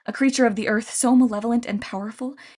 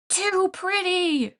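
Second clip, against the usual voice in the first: high, slow, strained monster cry; monster shriek; 10 semitones higher, much slower than usual. A narrator's reading. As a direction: shriek it out like a pained Cyclops. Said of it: "the Cyclopes shrieked".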